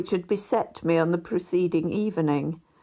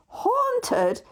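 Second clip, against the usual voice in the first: a much higher, disbelieving Haunted?; incredulous. A narrator's reading. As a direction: leap up in disbelief. Narrator hears rooms are haunted.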